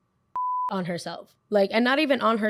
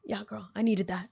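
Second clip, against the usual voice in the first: whisper; 5 dB quieter than usual. Retold or imitated. Thanking baby for a hug.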